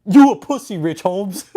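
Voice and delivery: high, loud wheezy taunt at Rich Holmes; loud jeering cult-leader taunt; mocking David's taunt. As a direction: bark it like a winded bully sitting on his chair. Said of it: "he was like".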